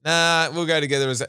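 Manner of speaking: high, loud mock refusal; defiant student. Student arguing with the speaker's rules, loud mock defiance. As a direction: play a kid arguing back.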